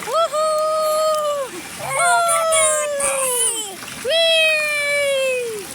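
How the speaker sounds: high gleeful whoops; cheering. Real, not acted. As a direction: whoop with delight. Cheering ride down. (masayang hiyaw)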